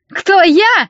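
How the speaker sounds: high, loud indignant screech; shrill outburst, voice shooting up; hysterical squeal. A narrator's reading. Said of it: "истерично завизжал Костя".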